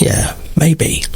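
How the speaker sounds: whisper. Real, not acted. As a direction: breathe it out unvoiced. Unvoiced whisper in chat.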